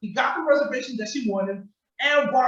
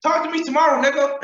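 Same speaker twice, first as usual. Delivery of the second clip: louder, higher, mocking the boyfriend; higher, louder mock sign-off; mock petty sign-off; 5 semitones higher, 5 dB louder than usual. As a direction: throw it out like a sulky parting shot. Mocking his petty parting shot.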